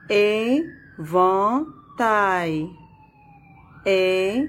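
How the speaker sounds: "éventail" in three beats, é, ven, tail; long pause after each syllable of "éventail"; split into syllables. Shown as a demonstration. Teacher locating the sound in third syllable, split into syllables. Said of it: "dans la troisième syllabe".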